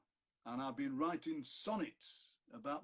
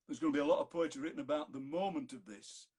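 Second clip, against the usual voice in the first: ordinary talk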